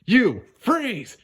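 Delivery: high voice, commanding call; Judy ordering. Acted. Judy ordering the thief to freeze, high voice. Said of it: "she said".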